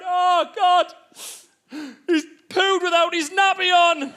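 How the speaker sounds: high mock wail of horror; dismayed. Wife breaking bad news through the wall, dismayed. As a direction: announce a disaster in a high voice. Wife reports the toddler's accident.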